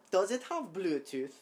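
loud, badly faked German accent; attempted German accent. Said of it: "attempted German accent".

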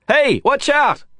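high, loud cry of alarm; warning shout. A narrator's reading. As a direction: shout out to stop a crash. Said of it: "My shout alerted the taxi driver".

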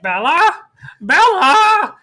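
hammed-up cry for a daughter; mock calling out. Mom on the recording calls Bella, mock frantic call.

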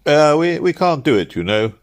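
high, loud, stammering refusal; flustered clerk. Acted. Cook's clerk to an unusual customer, flustered.

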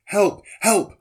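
high, loud cry for help; desperate shout. A narrator's reading. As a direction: shout for help while drowning. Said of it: "I shouted".

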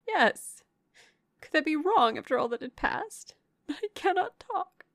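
high, plaintive, breaking off; protest, then give up on talking; overwrought distress. A narrator's reading. Questioned sister too upset to go on, overwrought distress.

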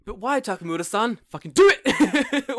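hammed-up ancestor bellowing; mock shouting. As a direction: ancestor spirit yelling at knife maker, hammed-up shout. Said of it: "And he's like".

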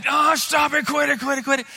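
high, loud panicked squeal; freaking-out little brother. Acted. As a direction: beg frantically as the pinned little brother. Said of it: "scrawny little brother is freaking out".

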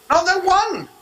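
high-pitched, insistent correction; emphatic rebuttal. Real, not acted. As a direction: cut in high to set it straight. Rejects the acted divine dialogue.

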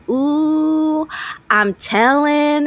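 high, slow, drawn-out tattletale; sing-song "Ooh, I'm telling" to tattle; a bratty kid's taunt, imitated; catchphrase imitation. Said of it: "She's the one who said".

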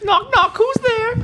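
high, far-off silly voice. Retold or imitated. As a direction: quote it in a squeaky voice. Movie quote.

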